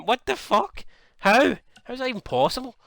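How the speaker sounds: high-pitched real disbelief; incredulous. Real, not acted. Gamer stunned Sami Zayn beat Brock Lesnar, incredulous. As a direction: pitch leaping up in baffled protest. Shocked by simulated match upset.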